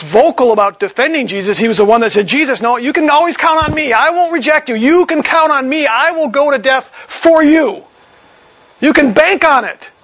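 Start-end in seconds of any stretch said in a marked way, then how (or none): 2.3-9.9 much higher, earnest and confident; a hint of bold Peter boasting; eager loyal pledge. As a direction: promise it with all your heart.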